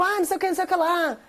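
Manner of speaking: high, fast eager chatter; a lightly voiced excited kid; chattering daughter.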